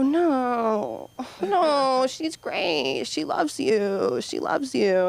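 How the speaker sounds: high, sing-song cover-up for a coworker; fake reassuring. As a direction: sweetly insist she's great.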